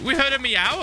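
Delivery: high, loud mock insistence; hammed-up high protest; imitated excited chat. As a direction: squeal it like overeager fans. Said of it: "And they're like".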